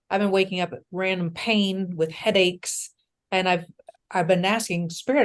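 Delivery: ordinary talk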